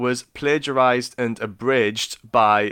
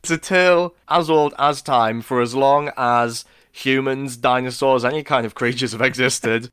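mock grand quote; 4 semitones higher, 6 dB louder than usual. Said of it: "we're gonna get to that a lot".

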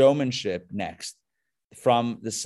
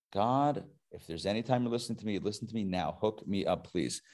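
low, hushed prayer, trailing softer; private plea; 4 semitones lower, 8 dB quieter than usual. Acted. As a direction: murmur a casual favor asked of God. Paraphrases Elijah's prayer as private plea.

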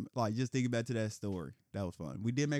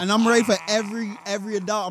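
much louder, higher boast; loud fired-up claim; 6 semitones higher, 10 dB louder than usual. Real, not acted. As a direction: belt it out defiantly. Heated boast continues.

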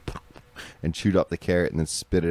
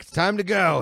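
mock grandpa; 12 semitones higher than usual. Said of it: "be like".

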